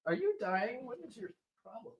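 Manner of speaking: high, soft aside to a dog; talking to pet. Real, not acted. Podcaster turns aside to his sneezing puppy, high and soft. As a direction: raise the pitch and drop the volume, teasing the puppy.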